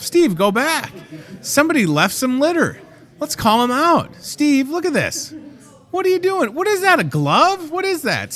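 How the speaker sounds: high, excited call-out across the store; calling out Steve. Real, not acted. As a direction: call Steve out for the litter, playfully. Calling out Steve about litter.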